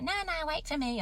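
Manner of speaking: high, mock-whiny husband voice; husband calling after wife on the run, high mock whine; husband begging. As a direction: imitate him pleading her to wait. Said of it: "He's like".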